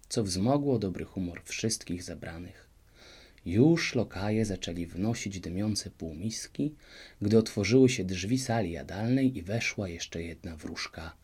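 ordinary delivery

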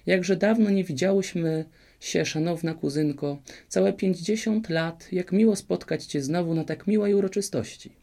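quick, lively. A narrator's reading. Merry fairy gushes at her sour cousin, high and lively. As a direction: chatter sweetly to smooth things over. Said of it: "zaczęła mówić prędko i żywo".

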